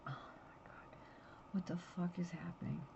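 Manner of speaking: retold frantic whisper; hushed retold panic at his slumped body; panicked whisper. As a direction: whisper it in a rush of fear. Retold panic finding husband hunched.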